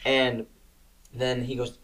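usual speaking voice

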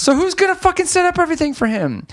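high, fast incredulous rant; exasperated. Retold or imitated. Griping about the host left with no crew, exasperated. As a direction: race through it in comic disbelief. Ranting about the film's nonsense.

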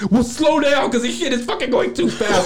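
high-pitched, mock-panicked plea; comic freak-out with laughter creeping in. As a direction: act out a stoned passenger panicking. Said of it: "I'm, like, freaking out".

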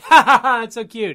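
high, loud fake chuckle; mock laugh. Reporters laughing at Hurley, high and loud. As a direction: laugh him off condescendingly.

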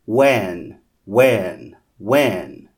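usual speaking voice

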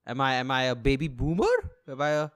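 ordinary talk

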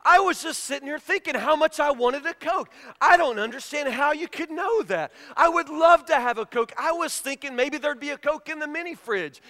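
high, loud, hammed-up astonishment; amazed and eager. Husband answering wife's offer of a Coke, acted amazement. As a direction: gush as if your mind was just read.

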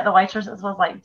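ordinary delivery

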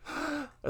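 sharp high intake of breath; a quick startled gasp, recreated; imitated gasp. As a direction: replay the shocked gasp. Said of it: "like, gasped a little bit".

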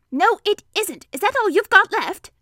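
high, loud protest; indignant Piglet. A narrator's reading. Piglet seeing the emptied honey jar, high indignant protest. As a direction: question it in a high, put-out voice.

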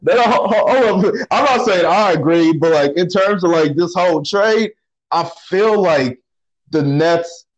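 high, pushing back excitedly; excited protest. Real, not acted. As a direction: jump in fast to object. Protests co-host disagreeing.

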